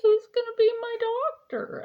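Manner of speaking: high, loud, whiny plea; hammed-up high pleading voice; mock pleading. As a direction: squeak it out like a begging kid. Retelling pleading with her doctor.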